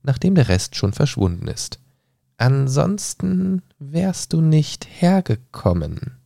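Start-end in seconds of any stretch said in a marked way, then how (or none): 2.4-6.2 high, slow, sly cat voice sinking to a whisper; drawn-out grinning cat, fading off; Cheshire cat voice. Acted. Cat tells Alice everyone here is mad, slow and eerie. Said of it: "sagt die Katze".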